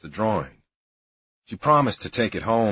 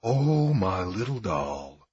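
slow, cooing; slower than usual. Retold or imitated. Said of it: "the priest said".

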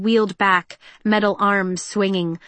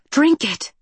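sharp, higher command; ordering; 6 semitones higher, 4 dB louder than usual. A narrator's reading. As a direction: order him to drink. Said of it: "she ordered".

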